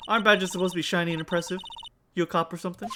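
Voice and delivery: a hint of a country Southern woman; Southern accent. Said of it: "I know the accent".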